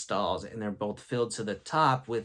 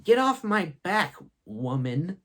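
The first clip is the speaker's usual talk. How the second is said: high, loud sudden outburst; abrupt, raised-voice classroom outburst; blurted out; 6 semitones higher, 3 dB louder than usual. Retold or imitated. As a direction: blurt it out as a higher-pitched retort. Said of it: "I randomly blurted out".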